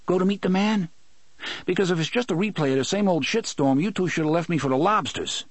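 put-on high, fast, challenging young man's voice; Eddie, bitter. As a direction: throw the questions at him, half accusing. Eddie confronting Roland.

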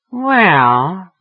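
long, high, loud rising word; coy hesitation. A narrator's reading. Woman weighing an invitation, coyly hesitant. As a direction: draw it out, swooping upward.

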